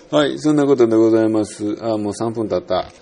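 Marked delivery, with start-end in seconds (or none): 0.1-1.8 mock formal sign-off.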